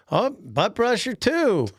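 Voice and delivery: high, slow, singsong jeer; drawn-out playful ribbing; mock teasing. Coworkers teasing whoever bumps into someone, high mocking singsong.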